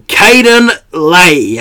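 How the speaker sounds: drawn-out, high and loud like a ring announcer; big guest announcement. Acted. Host introducing the guest on his show, announcer style. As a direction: stretch the name out to hype the guest.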